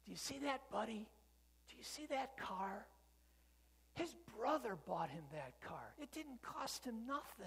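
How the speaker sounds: put-on high, eager kid pointing out a car; a proud boy showing his little brother; big brother to crippled sibling, awed and high.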